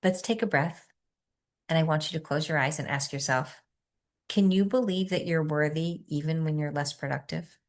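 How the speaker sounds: soft, quiet meditation guidance; guided meditation. Acted. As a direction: ease the group into stillness softly.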